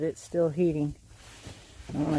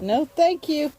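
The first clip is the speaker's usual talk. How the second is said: high voice for the hens; voicing the chickens; 10 semitones higher, 5 dB louder, slower than usual. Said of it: "They said".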